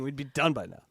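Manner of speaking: ordinary delivery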